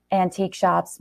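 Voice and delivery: usual speaking voice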